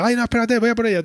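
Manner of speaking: high-pitched, hurried fluster; a startled yelp of someone running after a ball; flustered dash. Retold or imitated. Joey rushing after the lost ball, high and flustered.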